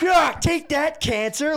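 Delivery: high, loud battle cry; sword-wielding Australian grandmother. Acted. Comic playing grandmother fighting cancer with a sword, battle cry. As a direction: shout it like you are swinging a sword. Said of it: "out there with, like, a sword".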